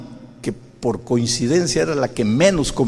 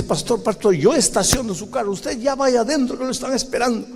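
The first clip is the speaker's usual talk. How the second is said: higher, hurried helpful voice; eager old usher; 10 semitones higher than usual. Retold or imitated. Old man at the door hurries the pastor in, higher and eager. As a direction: urge the pastor inside, eager. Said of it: "me dice".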